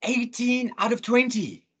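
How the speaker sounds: put-on excited child, pitched high; imitating a thrilled daughter. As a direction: announce it proudly, high and drawn out. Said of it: "I can see she's excited".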